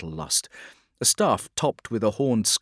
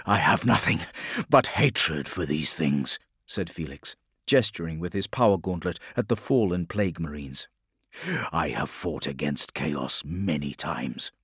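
low hateful growl; 5 semitones lower than usual. A narrator's reading. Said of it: "his aggressive tone".

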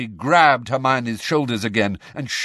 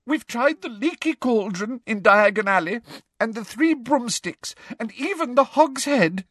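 high-pitched tearful listing; put-on weepy girl; sobbing. A narrator's reading. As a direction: wail through a feigned confession. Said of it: "sobbed Hermione".

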